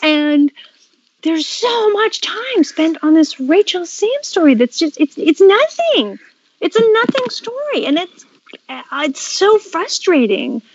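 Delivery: exasperated, pitch climbing; frustrated rant. Real, not acted. Venting about the Rachel Sam story.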